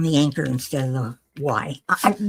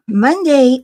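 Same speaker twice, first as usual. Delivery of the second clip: "Monday" higher and louder, announced with a push; emphatic Monday; 7 semitones higher, 9 dB louder than usual. Real, not acted. Telling viewers about her surgeon appointment, "Monday" said high and loud. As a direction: lift "Monday" up, a big announcement.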